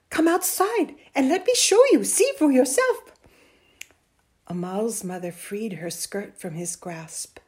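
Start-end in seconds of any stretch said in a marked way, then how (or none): 0.1-3.1 high, loud, eager urging; urgent pleading. A narrator's reading. Boy wants mother to come outside, urgent pleading. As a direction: coax insistently, voice lifted.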